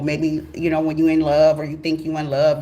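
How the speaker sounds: ordinary talk, nothing marked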